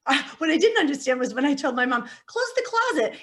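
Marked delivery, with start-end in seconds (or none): 2.3-3.2 put-on childish plea. Retold child plea to mom.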